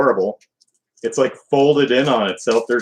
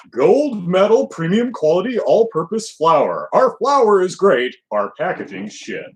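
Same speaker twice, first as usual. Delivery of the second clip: mock slogan; 6 semitones higher than usual. Mocking the flour's slogan.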